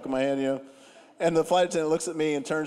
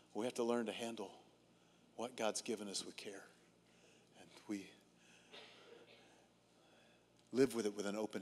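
soft, hushed sermon appeal, much softer; quiet preaching; 5 semitones lower, 11 dB quieter than usual. Acted. Preacher urging the congregation to surrender, soft intimate preaching.

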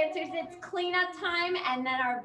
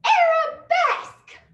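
"Arabesque" called out high, loud and slow; drawn-out teaching call; 12 dB louder, slower than usual. Shown as a demonstration. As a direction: stretch "Arabesque" out for kids. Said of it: "we're gonna say".

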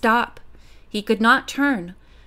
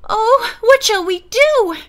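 high, loud worried wail; anxious; 11 semitones higher, 6 dB louder than usual. A narrator's reading. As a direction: panicky helpless question. Frightened friend after cries for help.

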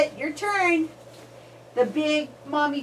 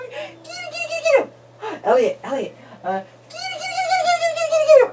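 very high sing-song call; high kitty call; much faster than usual. Real, not acted. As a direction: squeal it like calling a cat. Calling to babies.

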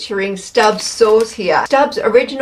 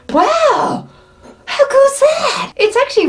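amazed. Real, not acted. Amazed at cupcake.

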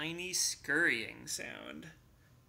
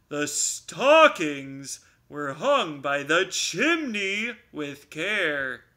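louder repeat; 8 dB louder, slower than usual. Acted. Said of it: "Bear repeated more loudly".

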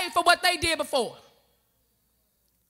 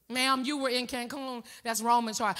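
soft, low customer service voice; a lightly voiced bored phone rep; 6 semitones lower, 6 dB quieter than usual. Phone company agent explaining a caller's bill, soft and low.

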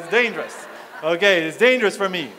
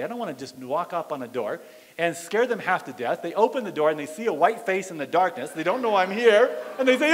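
ordinary delivery

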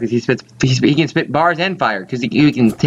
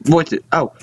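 ordinary delivery

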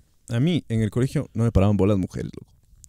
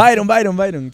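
loud, high meme call; imitating a meme guy, pitched up; meme impression; 9 semitones higher, 6 dB louder than usual. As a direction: shout the name like a meme catchphrase. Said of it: "yo creo que a mí me sale".

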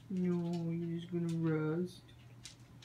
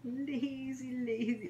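singsong teasing; 8 semitones higher than usual. Real, not acted.

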